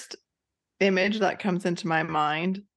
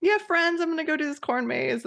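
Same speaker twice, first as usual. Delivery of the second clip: carefree friend; 10 semitones higher than usual. Pretend.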